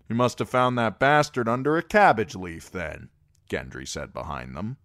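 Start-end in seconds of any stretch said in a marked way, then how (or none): 0.1-3.1 louder sarcastic aside; mocking jibe. Gendry mocking the talk of bastards, sarcastic. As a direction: jeer from behind.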